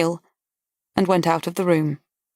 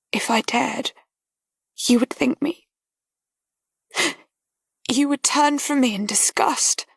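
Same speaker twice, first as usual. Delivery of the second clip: breathy, frightened confession; fearful whisper; 7 semitones higher than usual. Acted. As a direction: barely dare say it.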